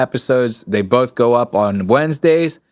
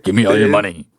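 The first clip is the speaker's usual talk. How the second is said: lightly imitated robber, lower; mock stick-up in a lower voice; a hint of a bass-voiced robber; deep mugger voice; 5 semitones lower, faster than usual. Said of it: "bass in that goddamn voice".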